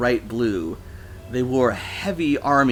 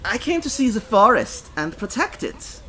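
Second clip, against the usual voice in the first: put-on high, earnest accented declaration; deadly serious; 12 semitones higher than usual. A narrator's reading. Foreigner explaining why they came, deadly serious. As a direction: state the mission with great earnestness. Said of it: "They sounded deadly serious".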